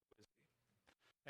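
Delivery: breathy whisper, almost no voice, slow; whispered question. Acted. Pastor hushes the crowd's question to a whisper. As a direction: drop to a hush and ask it slowly.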